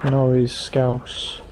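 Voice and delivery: slow and deliberate; slow, drawn-out correction. Real, not acted. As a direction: drag out each word. Correcting the Scottish guess.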